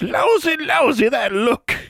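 put-on high, cracked old granny; old crone voice. A narrator's reading. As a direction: sneer it like an ancient hill woman.

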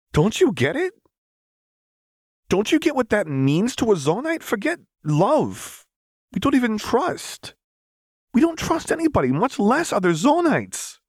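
high, loud, urgent pleading; rising intensity. Acted. Echo pressing Lux to understand, insistent and high. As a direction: push harder with each line.

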